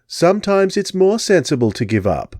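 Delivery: high English lady voice, cool; reply calmly in an English accent; Englishwoman. Acted. Englishwoman advising Jupiter, English accent. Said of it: "the woman replied".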